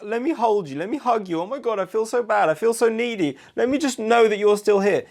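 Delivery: put-on needy boyfriend parody, high and whining; mock needy voice. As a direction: overdo the neediness. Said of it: "don't be like".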